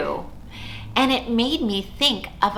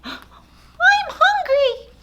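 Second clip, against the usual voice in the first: very high, slow squeaky mouse voice; a timid little mouse; tiny mouse; much slower than usual. Acted.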